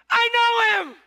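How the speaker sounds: loud, high proclamation; preacher's joyful cry; joyful shout. Acted. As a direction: shout it with joy.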